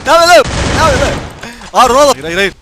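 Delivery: loud, high shouts of disbelief; excited shout. Real, not acted. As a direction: yell at the screen in shock. Shouting at chaotic game action. (heyecanla bağırarak)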